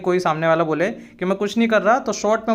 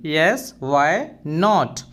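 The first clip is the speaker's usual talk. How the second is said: 1 slow teaching demo; 3 semitones lower, much slower than usual. Shown as a demonstration. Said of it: "simply short sentence में हम बोल देंगे".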